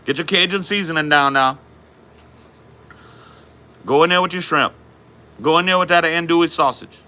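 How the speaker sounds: high-pitched Cajun cooking host; put-on Louisiana Cajun accent, pitched well up. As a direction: play a Cajun cook announcing the recipe. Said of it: "How's your Cajun accent so good?".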